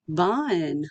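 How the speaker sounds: BUN said as one natural word. Shown as a demonstration. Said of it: "then you can say it faster".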